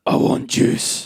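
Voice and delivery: slow, rough Glaswegian growl; croaked Glasgow accent, drawn out; hungover Glaswegian girlfriend. Acted. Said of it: "I had a Glaswegian girlfriend".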